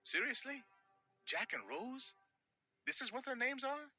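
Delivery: high, disbelieving outburst; can't believe it; incredulous. Real, not acted. Narrator incredulous aside.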